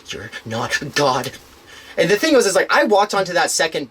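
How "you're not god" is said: slow, low retelling of her words; imitated unstable stranger. Retelling a stranger's words from the bus, slow and understated. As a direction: say it slowly and low, as if quoting her. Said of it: "she's like, she's like".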